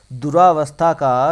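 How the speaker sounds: दुरावस्था drawn out loud with a long raa; wrong form. Shown as a demonstration. Teacher showing students the misspelt word, wrong form said loudly. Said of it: "दुरावस्था नहीं होता".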